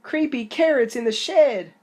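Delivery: high, loud frightened cry; panicked shout. Acted. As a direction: shout the warning in terror. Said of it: "Jasper screamed".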